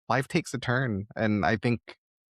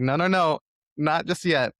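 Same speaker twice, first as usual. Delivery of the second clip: playful refusal; 7 semitones higher than usual. Retold or imitated. Host voicing fate's last-second save, playfully protesting. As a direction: wave off giving up with a playful no, no, no. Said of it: "And it's just like".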